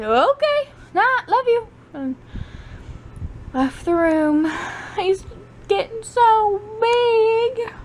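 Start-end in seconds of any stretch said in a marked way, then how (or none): 0.0-1.7 much higher, lilting okay, unbothered; sing-song brush-off. Retold or imitated. Mother answers her son's sulk with a high sing-song goodnight. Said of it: "I said". 5.7-7.7 fond. Real, not acted. Fond aside about her son.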